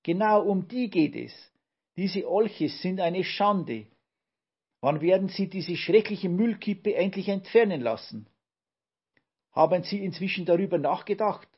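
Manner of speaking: cleaning lady berating the mayor, indignant. A narrator's reading. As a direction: scold the mayor with rising outrage. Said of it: "rief Yvonne".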